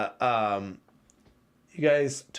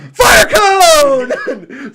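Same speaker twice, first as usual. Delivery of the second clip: spell shouted; 11 semitones higher, 15 dB louder than usual. Retold or imitated. Shouting his spell at uncle.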